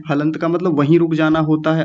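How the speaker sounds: ordinary talk, nothing marked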